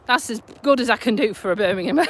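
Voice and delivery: usual speaking voice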